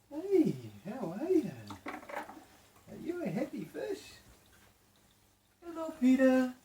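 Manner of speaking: put-on high, slow cooing baby voice; pet talk. Owner coaxing scared new fish with high, slow cooing.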